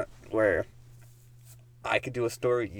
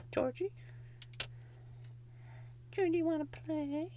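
put-on creepy child voice; 12 dB quieter than usual. Calling Georgie to play, creepy.

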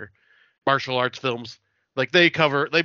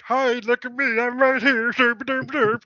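much higher, goofy cartoon ghost voice; silly mock ghost; 11 semitones higher, 3 dB louder than usual. Mocking the idea of ghosts waving hello, goofy high cartoon voice. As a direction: play a dopey ghost showing off. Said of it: "Ghosts aren't just gonna be like".

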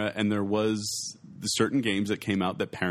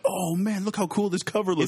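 put-on excited kid; 9 semitones higher than usual. Kid amazed at game box.

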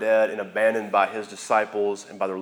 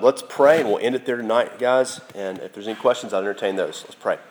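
ordinary talk, nothing marked

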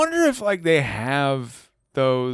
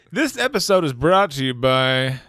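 ad read; 5 semitones higher, 5 dB louder than usual. Pretend. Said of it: "mock sponsor read".

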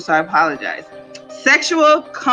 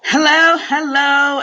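host greeting; 4 semitones higher, 3 dB louder, slower than usual. Acted. Opening the show.

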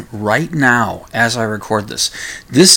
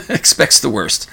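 laughing; 5 semitones higher than usual. Real, not acted. Laughing at own pessimism.

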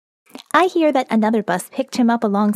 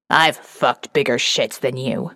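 much lower, rough-edged Jeb insult; Jeb's voice; 10 semitones lower than usual. Acted. Narrator quoting Jeb's classic insult, in a low voice. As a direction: drop the voice low and throw it like a brawler. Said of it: "my little notebook of Jeb-isms".

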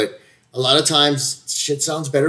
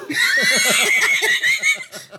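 high shrieking laugh. Real, not acted. Big laugh.

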